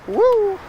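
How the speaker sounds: a high happy whoop; joyful woo. Real, not acted. Joyful cry while riding.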